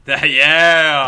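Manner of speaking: loud laughing cheer; excited. Real, not acted. Cheering the rant.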